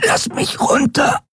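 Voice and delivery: strangled, high and slow plea; choked gasping. Acted. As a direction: force it out while being throttled. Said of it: "röchelte sie".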